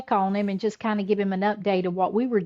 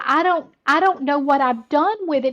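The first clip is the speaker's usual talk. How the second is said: higher-pitched imitation of flustered distress; retold frantic worry; 6 semitones higher, 3 dB louder than usual. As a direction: play a husband at a loss. Retold husband frantic over lost gift.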